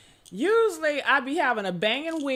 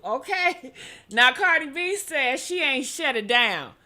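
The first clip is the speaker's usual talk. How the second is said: ordinary talk, nothing marked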